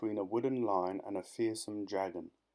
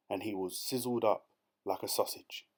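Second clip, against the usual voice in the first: ordinary delivery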